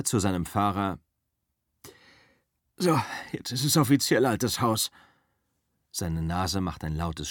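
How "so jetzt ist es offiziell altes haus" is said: a hint of a tired, washed-up actor; lightly voiced weary star, a bit higher; Rick's weary voice. Rick tells his driver it's over, lightly voiced and weary.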